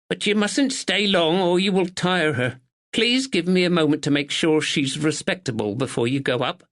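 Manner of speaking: a proper village neighbour, a touch anxious; spinster neighbour. A narrator's reading. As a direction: speak as a dutiful northern spinster. Miss Bennet voiced.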